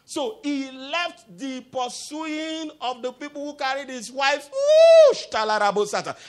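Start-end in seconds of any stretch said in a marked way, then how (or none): 4.5-5.3 loud high whooping whoosh; sudden whoosh. Real, not acted. Preacher bursts out mid-story, high loud whoosh. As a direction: let out a piercing whoosh.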